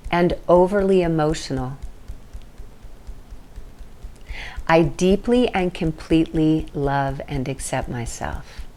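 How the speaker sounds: slow, measured tapping affirmation for others to echo. Shown as a demonstration. Teacher leads viewers through the tapping setup statement, slow and measured. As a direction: lay each phrase down slowly and pause for the echo. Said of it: "repeat after me in words and action".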